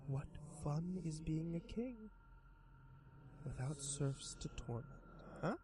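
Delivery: high, slow coaxing monster voice; sly creature voice. Acted. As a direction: tempt him like a grinning demon. Creature mocks boy.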